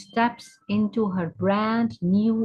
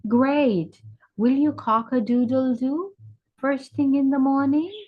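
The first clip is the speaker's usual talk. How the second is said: lightly voiced cow, high and quick; eager interviewing cow; 6 semitones higher than usual. Cow asks the bird about morning crowing, eager. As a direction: ask brightly, pitch climbing at the end.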